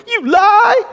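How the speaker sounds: put-on very high, loud child's cry; be a wounded five-year-old shouting back; a five-year-old boy yelling at someone he disagrees with; hurt angry little boy. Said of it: "in this little hurt, angry voice".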